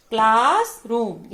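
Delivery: "Classroom" loud and drawn out, in two parts; syllable-by-syllable demo. Shown as a demonstration. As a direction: stretch both halves of "classroom". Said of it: "दोनों जो हैं classroom".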